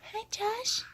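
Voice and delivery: high, soft rising coo. Real, not acted. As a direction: coo it up gently at him. Mother coos at the baby.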